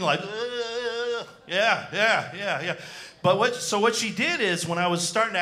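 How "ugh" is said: mock drooling vegetable groan; a slack, dopey groan, hammed up. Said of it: "a drooling vegetable impression".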